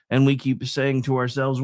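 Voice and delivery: ordinary delivery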